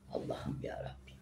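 hushed plea to God under her breath; whispered prayer. Real, not acted. Woman recalling the hospital ordeal, whispering a prayer. Murmured prayer after grim memory.

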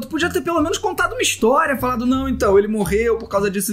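high, exasperated reproach; high indignant scolding. Real, not acted. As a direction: scold her in disbelief. Scolding builder for killing pet. (bronca indignada e aguda)

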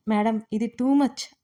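ordinary delivery

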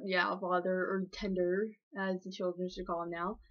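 ordinary delivery

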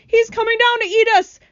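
loud, high terrified wail. A narrator's reading. Old grasshopper fearing he'll be eaten, wailing. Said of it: "wailed the old green grasshopper".

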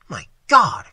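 loud, startled exclamation; startled cry. A narrator's reading. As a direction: cry out in alarmed earnest. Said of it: "startled into earnestness".

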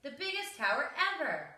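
high boastful shout. A narrator's reading. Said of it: "he shouted".

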